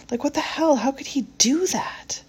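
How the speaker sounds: high, incredulous, trailing into a whisper; can't believe he did that; exasperated. Acted. Exasperated ex-girlfriend.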